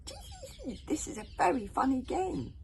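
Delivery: very high tittering; hogfly giggling at game. Acted. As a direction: giggle through it.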